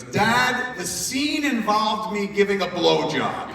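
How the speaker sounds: high, loud exasperated daughter; frustrated daughter. Retold or imitated. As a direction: blurt it out fed up with dad. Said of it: "the kid gets real frustrated".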